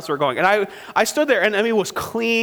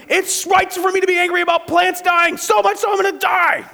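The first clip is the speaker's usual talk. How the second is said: high, loud mock tantrum; squeaky childish wail, building to a shout; whiny toddler Jonah; 13 semitones higher, 4 dB louder than usual. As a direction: play Jonah as a shrieking two-year-old. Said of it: "Is he two? Or how old is this guy".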